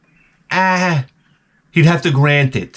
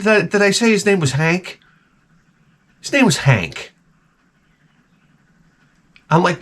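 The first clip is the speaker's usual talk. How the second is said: ordinary talk, nothing marked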